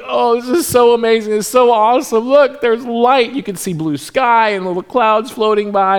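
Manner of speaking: high, awestruck gushing; imitated amazed kids. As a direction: gush like children marvelling at the sky. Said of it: "it was just amazing for them".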